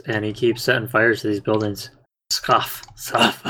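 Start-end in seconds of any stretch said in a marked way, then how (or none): 2.3-2.8 dismissive unvoiced scoff; scoffing dragon. Game master voicing the dragon's dismissal, scoffing. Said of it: "That's how he scoffs". 3.0-3.4 short breath of a scoff; scoffing dragon. Acted. Game master showing how the dragon scoffs, scoffing. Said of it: "That's how he scoffs".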